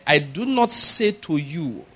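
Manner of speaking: slow, weighty stress on not; emphatic NOT. Retold or imitated. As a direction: lean hard on not, slowly. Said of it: "it was an emphatic word".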